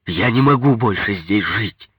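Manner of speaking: exasperated cry of refusal; desperate insistence. A narrator's reading. Husband's desperate outburst in quarrel.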